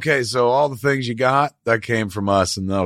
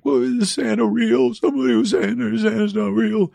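put-on child's voice; 9 semitones higher than usual. Young daughter asks her parents whether Santa is real, in a child's voice. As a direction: ask it like a worried young kid.

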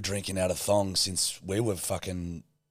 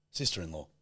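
ordinary delivery

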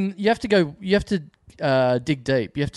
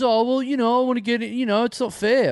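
whining punter; 7 semitones higher than usual. Retold or imitated. Acting out punter pleading for ticket.

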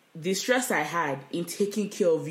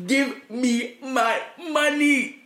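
slow, loud, high, laughing demand; passionate demand; 7 semitones higher, 7 dB louder, much slower than usual. Pretend. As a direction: drag it out, half laughing, half furious. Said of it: "I'm getting so passionate".

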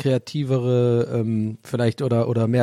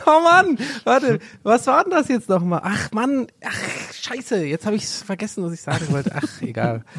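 high, frustrated self-scolding; groaning at forgetting; exasperated; 8 semitones higher than usual. Real, not acted. Frustrated after forgetting his point. (genervt und hoch)

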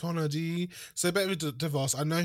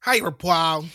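put-on Midwest accent; 3 semitones higher, 8 dB louder, slower than usual. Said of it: "tried to do this Midwest accent".

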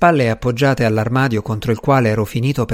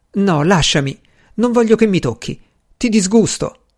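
higher, agitated refusal; distressed protest; 7 semitones higher than usual. A narrator's reading. Woman refusing to be touched, distressed protest. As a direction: fend him off, voice climbing in distress.

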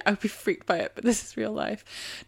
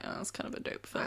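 whispered; 13 dB quieter than usual. Pretend. Voicing the witch's verdict.

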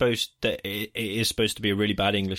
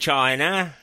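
high, hammed-up bad Australian-English accent; mock a terrible Australian-slash-English accent, pitched up; 5 semitones higher, 3 dB louder than usual. Imitating the boy band singer, bad Australian-English accent. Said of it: "very bad Australian slash English accent".

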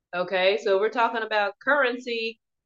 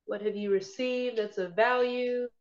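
guided meditation; 4 dB quieter than usual. Acted. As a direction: ease them gently into calm.

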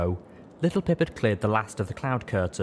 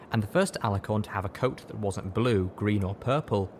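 plain reading voice

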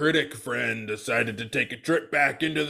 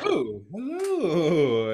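slow odd greeting; 4 semitones higher, much slower than usual. Acted. [name] greets slowly.

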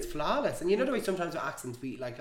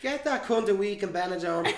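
loud joking demand; 6 semitones higher, 7 dB louder than usual. Real, not acted. Mock-indignant joke.

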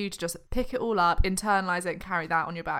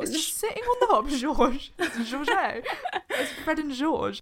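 laughing; 8 semitones higher than usual. Real, not acted. Laughing joke.

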